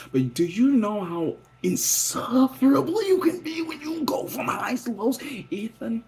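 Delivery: high, slow exasperated plea, turning to a whispered shout; whining at Ethan about his mood swings. Acted. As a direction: throw your hands up at him. Exasperated address to partner.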